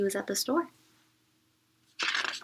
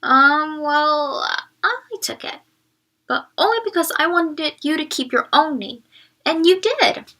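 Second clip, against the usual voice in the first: put-on embarrassed boy; 5 semitones higher, 7 dB louder than usual. A narrator's reading. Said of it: "Joey looked embarrassed".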